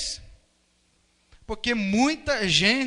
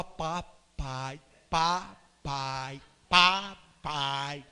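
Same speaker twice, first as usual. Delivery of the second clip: low, softer, drawn-out syllables; parent coaching baby; 8 semitones lower, 6 dB quieter, much slower than usual. Acted. Parent teaching the baby to say papai, slow coaxing. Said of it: "o que que o pai e a mãe faz".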